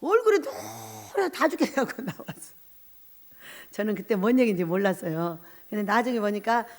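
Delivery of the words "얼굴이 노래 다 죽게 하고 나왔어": normal talking voice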